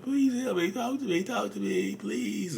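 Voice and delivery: very high, whining fake-crying plea; mock crying. Crying partner begging narcissist to talk, mock crying. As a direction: imitate a tearful begging wail, hammed-up. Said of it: "You'll start crying".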